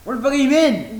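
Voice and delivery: high, loud demand; exasperated shout. Real, not acted. Host to co-host who missed news, exasperated shout. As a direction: shout the question at full force.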